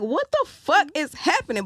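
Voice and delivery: high, loud retold freak-out; mock tearful bewilderment. Tripping, crying and laughing at once, mock bewilderment. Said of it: "I just start crying".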